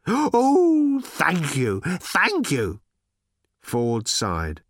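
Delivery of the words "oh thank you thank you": sharp gasp, then high, loud thanks; ecstatic robot. A narrator's reading. Little robot thanking Ford in ecstasy. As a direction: gasp and gush your thanks.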